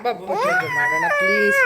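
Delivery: high sing-song coaxing to sleep. Real, not acted. Mother coaxing her crying baby to sleep, high sing-song voice.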